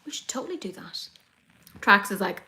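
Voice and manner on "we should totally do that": very soft whisper; hushed, voiceless aside; wistful whisper. Real, not acted. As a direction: whisper it like a secret wish. Wistful aside about the planned trip.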